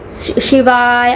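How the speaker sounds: long-held ending on शिवाय; one breath per word. Shown as a demonstration. Teacher showing breath-paced chanting, a slow stretched शिवाय. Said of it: "आपने फिर से सांस ली".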